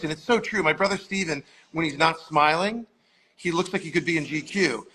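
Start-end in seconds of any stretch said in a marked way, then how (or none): none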